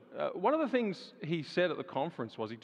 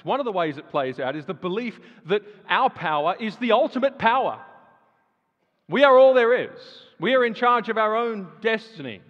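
rising preacher fervour; 8 semitones higher, 11 dB louder than usual. Real, not acted. Preacher mocks prideful belief.

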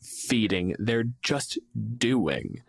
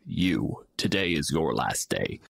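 low, slow roadside threat, a sinister troll; menacing troll; 7 semitones lower, slower than usual. Retold or imitated. Troll doomsaying to a passing traveler, low and menacing. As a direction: drop deep and loom over the passer-by. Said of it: "seeing a person go by and going like".

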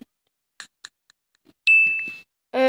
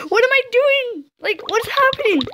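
much higher, frantic confusion; alarmed panic; 10 semitones higher than usual. Real, not acted. Player baffled by her own choices, high-pitched panic. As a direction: shoot up high in disbelief.

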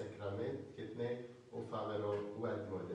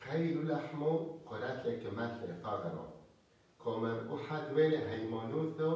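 raised, higher-pitched sermon voice; emphatic preaching; 3 semitones higher, 4 dB louder than usual. Real, not acted. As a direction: preach it out with rising conviction, louder on key phrases. (ܟܪܘܙܘܬܐ ܒܩܠܐ ܪܡܐ)

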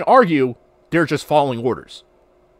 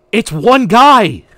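loud, slow, disbelieving; incredulous rant; 7 semitones higher, 9 dB louder, much slower than usual. Retold or imitated. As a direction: stretch it out, outraged. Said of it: "same exact rant".

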